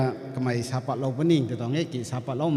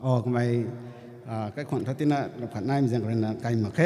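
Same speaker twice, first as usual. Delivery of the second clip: ordinary delivery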